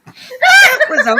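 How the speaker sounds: slow, high laughing. Real, not acted. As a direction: squeeze the words out through laughter. Laughing at the fake snore.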